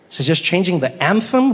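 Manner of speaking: usual speaking voice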